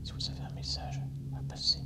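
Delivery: soft unvoiced whisper to a spirit. Real, not acted. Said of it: "je murmure".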